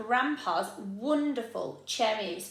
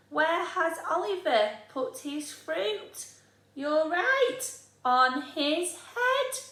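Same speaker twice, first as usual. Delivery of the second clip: bright, higher talk to little listeners; teacher voice to children; 6 semitones higher than usual. Acted. Teacher coaxing her pupils to answer, higher and lively. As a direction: ask the class and praise the answer.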